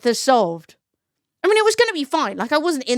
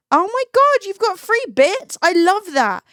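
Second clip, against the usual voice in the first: high, louder delighted burst; excited squeal; 7 semitones higher, 4 dB louder than usual. Real, not acted. Streamer reacting to free bits, high and excited. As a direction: gush over the gift.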